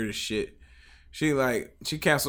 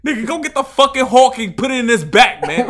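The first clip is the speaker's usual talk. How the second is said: mock gunman order; 12 semitones higher, 11 dB louder than usual. Imitating a New York gunman.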